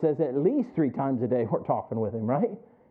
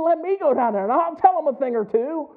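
higher, louder mock tough talk; mock riled-up threat; 11 semitones higher, 5 dB louder than usual. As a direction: play it like you're itching for a fight. Imagined angry outburst at TV.

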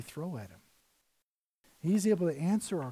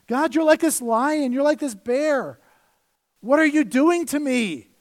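loud, slow, raised cry at God; anguished outcry; 6 semitones higher, 7 dB louder than usual. Acted. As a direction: hurl the complaint at God. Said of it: "casting blame and say".

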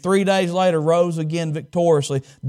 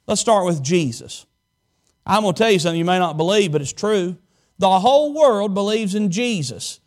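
ordinary talk, nothing marked

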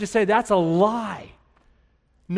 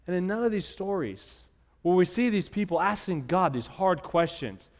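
ordinary delivery

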